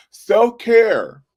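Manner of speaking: ordinary delivery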